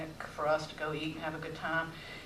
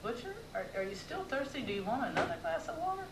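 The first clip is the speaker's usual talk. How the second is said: quick, disbelieving question, pitched higher; incredulous; 7 semitones higher, 6 dB quieter than usual. Retold or imitated. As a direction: ask it as if you can't believe it. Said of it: "incredulously, I asked".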